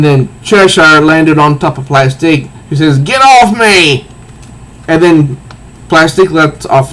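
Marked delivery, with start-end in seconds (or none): 3.0-4.1 mock angry. Plastique shoves off Cheshire.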